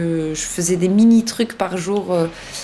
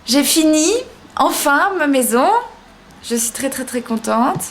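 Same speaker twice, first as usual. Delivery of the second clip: ordinary talk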